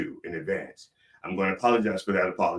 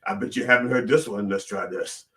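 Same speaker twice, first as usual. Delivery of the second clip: normal talking voice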